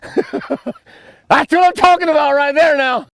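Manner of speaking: high, loud, slow whoop of joy; triumphant shout over a big permit; excited laughing shout. Real, not acted. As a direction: yell it out, thrilled with the catch. Whoops after landing the fish.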